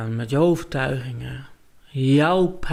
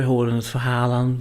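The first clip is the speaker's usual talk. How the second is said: ordinary delivery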